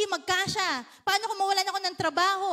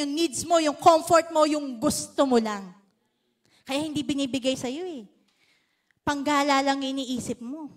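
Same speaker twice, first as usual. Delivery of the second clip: ordinary delivery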